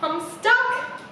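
lightly voiced helpless character; stuck Not. A narrator's reading. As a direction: call for a little help, pitch climbing at the end. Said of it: "Not's like".

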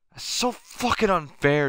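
real high-pitched cry of frustration; upset outburst. Real, not acted. Still raging over the lost game.

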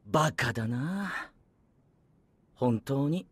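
heartfelt pity. Acted. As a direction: say it with deep sincere pity. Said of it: "しみじみというのはやめて".

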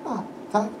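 ordinary delivery

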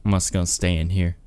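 ordinary talk, nothing marked

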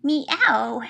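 imitated kitty meowing; kitten meows. Shown as a demonstration. Reader showing what kitties say, imitated meows. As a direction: meow like a little cat, one at a time. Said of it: "That's what kitties say".

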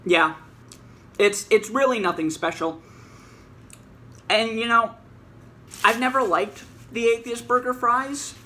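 eating between words; talking while chewing. Real, not acted. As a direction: mouth partly full of food. Seen 4: Puts fries in mouth at 3.4 and 6.0, chewing between.

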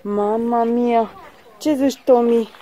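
normal talking voice

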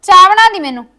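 loud, bossy scolding that builds to a shout; angry ordering. Acted. Sister-in-law orders the girl to cook and make tea, angry shouting.

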